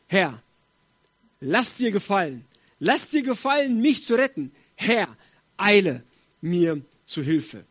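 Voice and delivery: emphatic, slow cry to the Lord; pleading psalm prayer. Acted. David to the Lord for rescue, imploringly.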